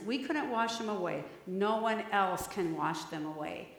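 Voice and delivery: put-on soft, hushed prayer for the children; children's sermon prayer, soft and tender; gentle prayer. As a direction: pray quietly over the little ones.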